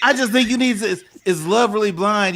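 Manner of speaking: laughing indignant question; laughing protest. Real, not acted. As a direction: defend yourself while cracking up. Defends point while laughing.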